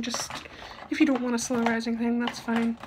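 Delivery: normal talking voice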